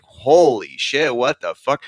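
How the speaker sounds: slow, drawn-out disbelief; stunned teen. Retold or imitated. Teen hearing the Misfits for the first time, slow stunned awe. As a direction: let it land like a first punk song hitting you. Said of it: "I was like".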